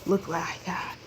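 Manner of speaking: soft unvoiced whisper, leaning in close. Real, not acted. Whispering to viewers while showing off a gift. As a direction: hush it like a secret for the viewers.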